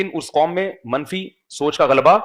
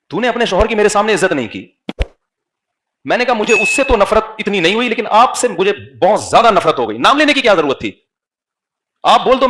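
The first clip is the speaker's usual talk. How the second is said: higher, louder rebuking sermon; forceful preaching; 5 semitones higher, 5 dB louder than usual. Acted. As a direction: scold the congregation from the pulpit.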